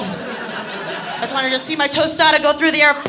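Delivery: ordinary delivery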